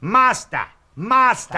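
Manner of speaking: high, loud mock pupil's yell. Retelling an ad to mates, pupil yelling for his master. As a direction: yell for your master in two bursts. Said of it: "he's yelling out".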